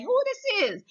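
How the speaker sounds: high, startled question; high amazed asking. Retold or imitated. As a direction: throw it up high in surprise.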